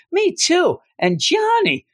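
squeaky put-on voice of a trapped heroine; mock high woman's voice. Fungus voicing captured heroes.

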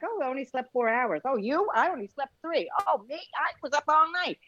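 lightly voiced show-offs topping each other; bragging about no sleep. Tired people bragging to each other, mock boastful. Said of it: "we're like".

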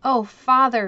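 a touch of sobbing grief, lightly voiced; heartbroken cry. A narrator's reading. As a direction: cry out to father as if heart breaking. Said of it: "cried as though his heart would break".

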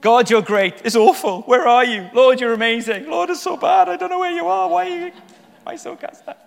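hammed-up swings from praise to lament; psalmist's mood swings. Preacher parodies the psalm's ebb and flow to God, overwrought. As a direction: rise from loud praise into very high, mock-distraught wailing. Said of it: "how much does that psalm ebb and flow".

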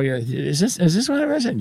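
high-pitched puzzled question. Acted. Acted exchange with significant other.

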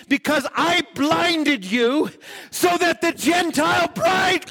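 high, drawn-out preaching cry; full-force preaching. Acted. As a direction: proclaim it high and slow, stretching the key words.

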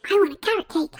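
usual speaking voice

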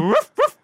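yappy high-pitched barks; high mock barking. Dog barking at the elk, high and yappy. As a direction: bark like an excited little dog.

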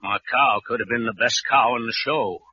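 ordinary delivery

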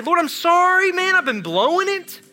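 pleading prayer. Retold or imitated.

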